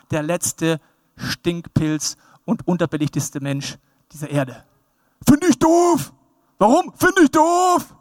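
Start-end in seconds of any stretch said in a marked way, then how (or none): 5.3-7.9 high, put-on petulant voice; hammed-up high-pitched grumbling; mock moaner.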